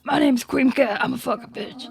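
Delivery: grouchy character voice; mock them as growling grouches; mocking the viewers' voice. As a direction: put on a crude, grumpy mocking voice. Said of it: "That's your guys' voice".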